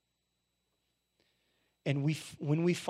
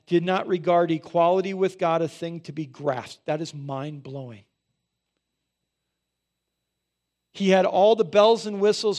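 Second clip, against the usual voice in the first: forceful scripture reading; 4 semitones higher, 9 dB louder than usual. Acted. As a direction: proclaim the verse to the church.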